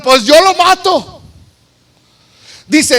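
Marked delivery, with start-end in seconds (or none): 0.0-1.2 raised, punchy soldier's offer; high-pitched eagerness to do it; eager volunteer. Acted. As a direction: jump in keenly as a fighter. Voicing soldiers volunteering to kill Saul.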